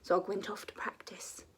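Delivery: ordinary delivery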